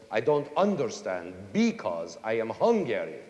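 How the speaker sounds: English with Hungarian first-syllable stress; Hungarian accent. Acted. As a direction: imitate a Hungarian, punching each word's first syllable. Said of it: "tell a Hungarian speaking English".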